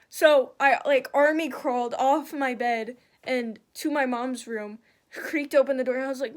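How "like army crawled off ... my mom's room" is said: ordinary talk, nothing marked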